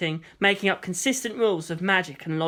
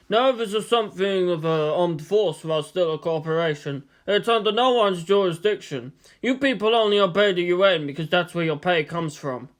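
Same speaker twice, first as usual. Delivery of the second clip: rotund director. Acted. Yanda explains nerve.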